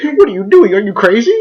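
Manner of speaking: high, loud mock disbelief; mock shocked classmates. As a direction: squeal it like gossiping kids.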